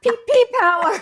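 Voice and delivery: high-pitched laughing shout. Pretend. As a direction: yell it high through laughter. Chanting a silly name.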